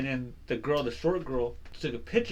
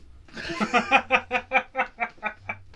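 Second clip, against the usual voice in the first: high laughing fit at own punchline; long giggling laugh; 9 semitones higher than usual. Real, not acted. Storyteller amused by own joke, laughing at length. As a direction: crack up after the punchline.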